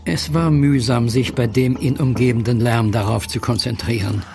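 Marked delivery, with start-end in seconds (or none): none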